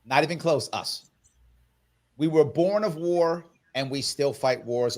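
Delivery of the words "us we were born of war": ordinary talk, nothing marked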